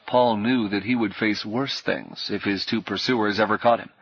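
ordinary delivery

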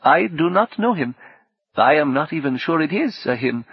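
high-pitched, careful character voice; woman guest's voice. Acted.

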